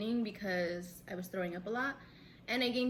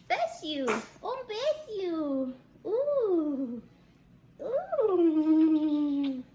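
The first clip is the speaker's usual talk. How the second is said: cooing bless-you; 6 semitones higher, 8 dB louder, much slower than usual. Real, not acted. Cooing at baby who sneezed.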